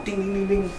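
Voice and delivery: high, light bell dinging; high-pitched mock bell; imitated ring bell. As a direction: chime like the bell starting a match. Imitating the wrestling match bell.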